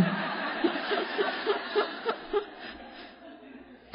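high, breathy burst of real laughter; laughing. Real, not acted. Laughing at own remark.

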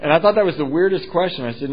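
ordinary talk, nothing marked

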